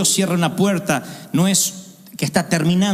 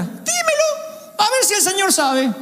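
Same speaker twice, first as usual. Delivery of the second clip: mocking God; 13 semitones higher, slower than usual.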